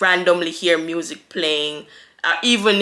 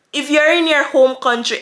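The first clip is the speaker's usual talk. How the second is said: ordinary talk, nothing marked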